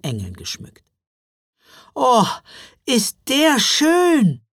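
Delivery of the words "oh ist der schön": high, loud, drawn-out rapture; gushing little girl's exclamation; delighted cry. A narrator's reading. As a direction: call it out, thrilled and slow. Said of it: "rief Mila und klatschte begeistert".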